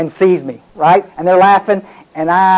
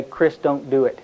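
low murmured warning voice; soft voice in his head telling Chris to stop; quiet inner voice; 5 semitones lower, 8 dB quieter, slower than usual. Retold or imitated. Voice in his head warning him, murmured. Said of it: "I hear this voice say".

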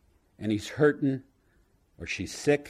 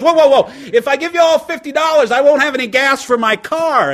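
put-on much higher, louder panicked drunk; backpedaling drunk; 12 semitones higher, 10 dB louder than usual. Drunk trying to back out of the deal, high and alarmed. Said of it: "trying to back out of the deal goes".

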